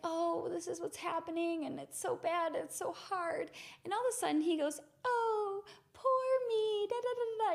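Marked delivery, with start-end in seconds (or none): 0.0-3.5 high, woe-is-me complaining; higher-pitched mock self-pity; self-pitying complaint. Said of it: "mocking me, making fun of me". 5.1-7.5 very high, singsong mock pity; exaggerated high-pitched "poor me" mockery; mocking self-pity. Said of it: "mocking me, making fun of me".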